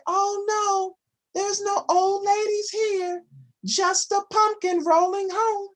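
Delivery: high, fibbing storybook granny; sweet old lady voice. Acted. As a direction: play the sly granny pretending.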